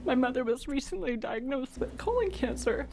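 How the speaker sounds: ordinary talk, nothing marked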